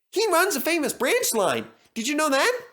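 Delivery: high, eager little engine voice; Percy voice. Acted. Percy boasting to the other engines, eager high voice.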